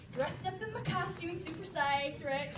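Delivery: ordinary delivery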